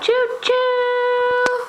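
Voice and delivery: high drawn-out choo-choo; train whistle. Shown as a demonstration. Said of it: "What does a train say?".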